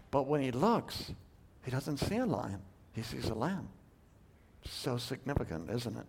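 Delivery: quiet wonder at the lamb; hushed awe. Real, not acted. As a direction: drop to a reverent hush. Contrast lion to lamb, hushed.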